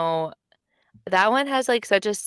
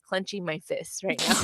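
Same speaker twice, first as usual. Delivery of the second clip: usual speaking voice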